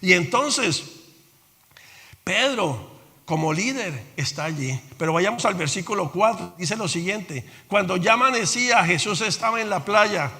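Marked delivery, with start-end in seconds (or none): none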